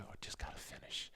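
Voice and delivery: a bare whisper, all breath; whispered self-pep talk before the moto. Retold or imitated. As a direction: mutter the resolve under your breath. Telling himself mid-race.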